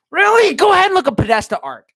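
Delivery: high, loud indignant burst; incredulous outrage. Acted. Streamer challenging a doubter of Pizzagate, outraged. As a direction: blurt it high and loud in disbelief.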